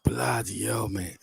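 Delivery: 2 breathless whispered curse; 3 hush it under your breath as the kick comes; 1 tense whisper. Real, not acted. 4 fan watching a penalty shootout, whispering in dread.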